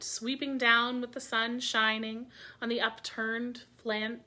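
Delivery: plain reading voice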